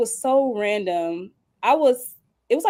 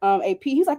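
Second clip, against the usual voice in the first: ordinary delivery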